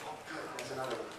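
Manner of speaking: normal talking voice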